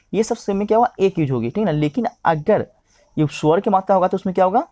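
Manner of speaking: normal talking voice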